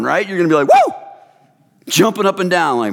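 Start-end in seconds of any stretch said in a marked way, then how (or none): 0.7-0.9 short excited "woo"; celebratory whoop. Acted. Believer jumping up and down at God's work, celebratory whoop. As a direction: let out a quick airborne whoop. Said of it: "you're gonna get airborne".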